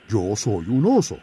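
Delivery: oso firme y sencillo; bear folk. Acted. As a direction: explica con calma que es oso. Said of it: "dijo el oso". (oso que protesta)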